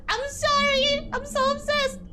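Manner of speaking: high, drawn-out apology; playful apology. Real, not acted. Streamer apologizing to chat for her obsession, playful high whine. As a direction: plead sorry in a high voice. Laughing apology after being teased.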